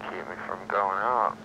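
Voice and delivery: drowsy, slowed-down drugged speech; sedated. Real, not acted. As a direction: talk heavy and sluggish, medicated. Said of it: "now you sound really slow motion".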